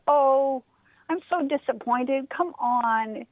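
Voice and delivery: a restless bunny begging his friend; pleading rabbit. Acted.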